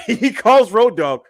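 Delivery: loud, high laughter into the words; slowed by a big laugh; real laughing. Real, not acted. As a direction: crack up while telling it. Cracking up while retelling the bit.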